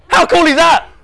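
high, loud mock-proud shout; crowing excitement. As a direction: show off gleefully at full voice. Crows about his own book.

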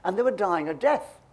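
ordinary talk, nothing marked

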